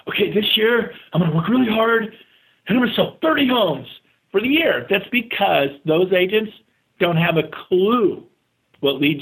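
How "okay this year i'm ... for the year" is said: higher, lightly put-on voice of a naive agent; a hint of a clueless agent announcing a goal; mocking typical agent. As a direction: parody an average agent's modest goal. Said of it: "Most real estate agents set a goal to sell".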